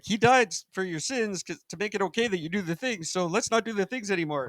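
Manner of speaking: mock-earnest high-voiced appeal; Peter reasoning. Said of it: "it'll be like".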